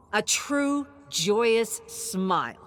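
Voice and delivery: plain reading voice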